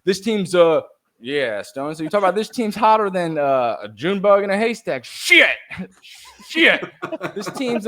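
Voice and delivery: hammed-up Kevin botching the line again; bad country accent. Imitating Kevin swearing over a missed zinger in a bad country accent. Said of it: "I don't do a good country accent".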